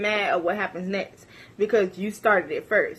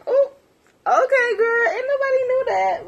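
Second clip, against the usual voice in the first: much higher, mocking "okay, girl"; sarcastic squeal; 13 semitones higher than usual. Mocking her actress dream, sarcastic squeal. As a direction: drip with sarcasm.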